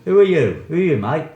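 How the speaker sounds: loud, high-pitched playful demand; mock challenge. Teacher plays Aussie challenging someone's identity, loud mock challenge. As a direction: call it out as a mock confrontation.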